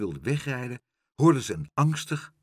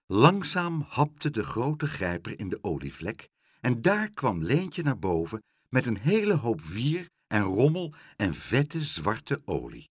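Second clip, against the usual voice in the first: plain reading voice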